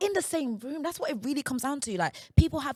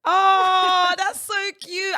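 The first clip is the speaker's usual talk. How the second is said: high, loud drawn-out "oh"; real laughing delight; delighted cooing; 8 semitones higher, 8 dB louder, much slower than usual. Real, not acted. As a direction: gush with a laugh, slowing down. Charmed by grandma's Yoruba reply.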